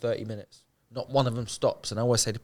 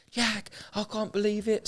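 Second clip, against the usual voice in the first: high, excited voice of a man overjoyed at a haircut; amazed delight; 10 semitones higher than usual. Retold or imitated. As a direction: gush with disbelief like a kid at a treat. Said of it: "he was like".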